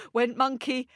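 usual speaking voice

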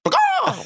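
very high silly squawk; imitated headbutt cry. Actor makes the crew laugh on purpose, silly squawk. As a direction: let out a piercing bird-like squawk. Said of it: "like that".

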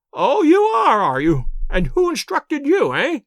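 put-on high, loud, sneering old man; indignant old lord. A narrator's reading. Lord Mount James sneering at the detective, high indignant. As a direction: scoff at the detective's nerve.

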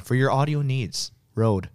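ordinary delivery

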